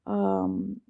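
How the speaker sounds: usual speaking voice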